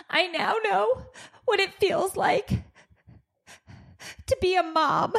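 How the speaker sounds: high, halting weepy imitation; mock tearful. Daughter feeling like a new mom, mock tearful. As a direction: play an overcome girl, breaking between phrases. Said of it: "she just starts crying".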